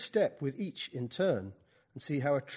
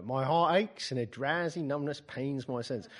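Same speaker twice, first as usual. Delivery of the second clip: put-on Keats read in a Cockney accent. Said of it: "Keats in a Cockney accent".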